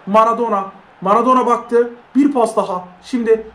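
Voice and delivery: usual speaking voice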